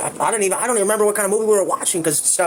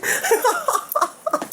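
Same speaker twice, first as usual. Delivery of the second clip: laugh. Real, not acted. Laughs at the memory.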